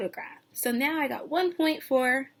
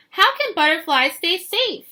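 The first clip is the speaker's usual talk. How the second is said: louder, punchy danger read; dramatic reading; 3 semitones higher, 8 dB louder than usual. Acted. Teacher reading the predators page aloud, louder and dramatic. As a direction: build the threat for the kids.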